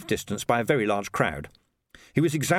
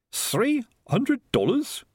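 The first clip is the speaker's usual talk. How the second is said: high, very slow disbelieving question; incredulous echo; 14 semitones higher, much slower than usual. Acted. Sergeant hears the piper's fee, slow incredulous question. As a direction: drag out THREE, rising in disbelief.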